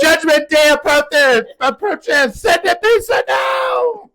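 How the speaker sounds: high, slow booming preacher; mock preacher. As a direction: proclaim like a revival preacher.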